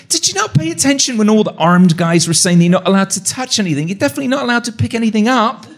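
high, exasperated retold telling-off; incredulous scolding. Host scolds co-host for picking things up, incredulous.

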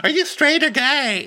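mock girly shriek of delight; squealing girls. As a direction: squeal it like thrilled party girls. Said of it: "They'd be like".

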